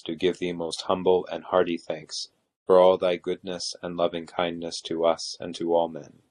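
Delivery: ordinary delivery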